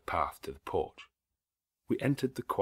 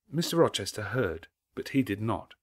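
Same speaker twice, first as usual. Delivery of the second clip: ordinary delivery, nothing marked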